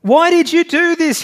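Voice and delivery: high, loud storyteller's yell of frustration; frustrated yell. A narrator's reading. Man to stranger hanging over the edge, yelling in frustration. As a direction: yell it high, demanding an answer. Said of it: "he yelled in frustration over the edge".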